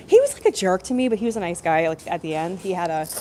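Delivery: ordinary talk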